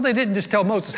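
usual speaking voice

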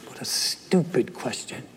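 slow, soft scornful mutter, barely voiced; mock scornful aside. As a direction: sneer it under your breath. Imagined God mocking.

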